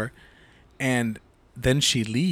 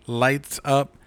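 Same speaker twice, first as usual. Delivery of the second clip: ordinary talk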